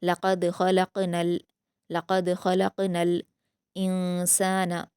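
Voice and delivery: slow, careful Quranic reading; slow recited verse; tajweed recitation. Shown as a demonstration. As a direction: draw the verse out slowly. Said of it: "लाम से मिला देंगे".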